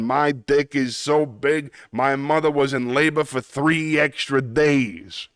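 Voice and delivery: cartoon Brooklyn wiseguy accent; Brooklyn tough guy. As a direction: boast it like a Looney Tunes Brooklyn tough guy. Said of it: "Yes, it's a Brooklyn accent".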